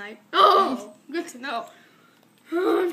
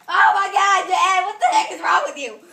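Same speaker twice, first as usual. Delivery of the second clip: exasperated shout; 3 semitones higher, 6 dB louder than usual. Acted. Shouting at the jumper.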